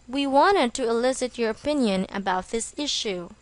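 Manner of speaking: ordinary delivery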